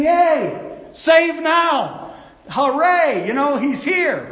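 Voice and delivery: loud, high jubilant cheer; cheering crowd. Retold or imitated. Preacher voicing Jerusalem crowd greeting Jesus, jubilant cheer.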